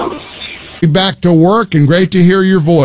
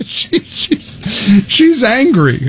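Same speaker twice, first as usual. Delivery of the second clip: laughing; 5 semitones higher, much slower than usual. Real, not acted. Host cracks up at the news.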